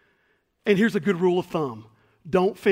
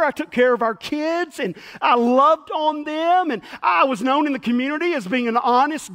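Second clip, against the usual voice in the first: higher, louder earnest list of his virtues; eager bragging; 6 semitones higher, 5 dB louder than usual. Acted. Man pleading his record to the angel, higher and louder. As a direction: keep piling on proof he is good. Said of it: "man listing merits".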